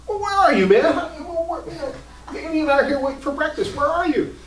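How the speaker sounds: high, loud laughing questions at a late intern; laughing at a late friend, high and loud; laughing impatience. Retold or imitated. Preacher on the phone to his late intern, laughing high and loud.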